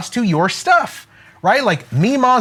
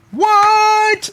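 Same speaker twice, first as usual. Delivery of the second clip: mock disbelief; 4 dB louder than usual. Mock amazement at own sales.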